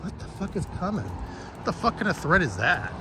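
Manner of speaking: high, hushed, fast disbelief; mock baffled outrage under the breath; incredulous inner reaction. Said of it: "I'm like".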